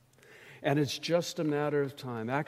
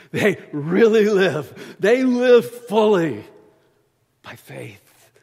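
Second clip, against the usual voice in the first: joyful preaching; 6 semitones higher, 14 dB louder, slower than usual. Real, not acted. Preacher exults over living fully.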